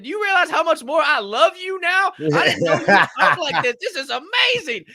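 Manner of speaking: high, loud, laughing praise; delighted laughing gush. Retold or imitated. He laughs and gushes to his friend about her cussing. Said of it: "I said".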